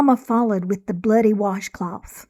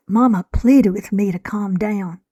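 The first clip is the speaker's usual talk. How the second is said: usual speaking voice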